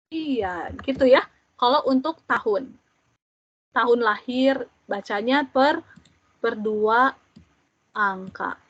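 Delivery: ordinary delivery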